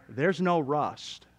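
ordinary delivery

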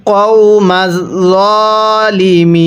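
কওমাজ জালিমিন drawn out slowly and joined; slow recitation. Shown as a demonstration. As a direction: chant কওমাজ জালিমিন slowly, linking the two words. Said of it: "এরকমভাবে পড়ব".

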